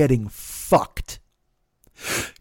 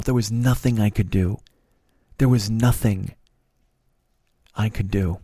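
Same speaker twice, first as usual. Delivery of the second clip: low, halting, deflated confession; helpless, subdued; 4 semitones lower than usual. Real, not acted. Driver reflecting after passenger left, helpless and subdued. Teller recalls helpless anger.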